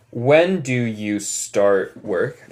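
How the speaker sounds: very slow, clear model sentence; teacher modeling slowly for the class to repeat; slow repeat-after-me. Shown as a demonstration. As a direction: say it slowly so the class can echo. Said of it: "Repiten después de mí".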